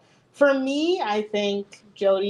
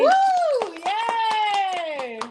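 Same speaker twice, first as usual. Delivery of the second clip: cheering; 10 semitones higher, 3 dB louder than usual. Real, not acted. Cheering at the show's end.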